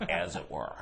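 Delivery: low, lightly voiced egghead; dry professor tone; egghead dad. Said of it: "He goes".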